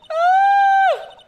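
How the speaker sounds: very loud, high held scream; sudden full-voiced shriek. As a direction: let out a piercing "Ah". Screams while reading the game.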